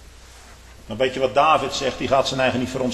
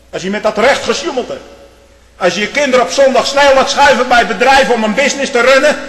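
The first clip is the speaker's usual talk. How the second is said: higher, louder pulpit warning; preaching with rising force; 7 semitones higher, 5 dB louder than usual. Acted. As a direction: press it home to the flock. Preacher warns the congregation.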